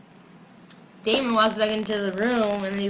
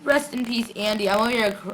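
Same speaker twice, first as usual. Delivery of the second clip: ordinary delivery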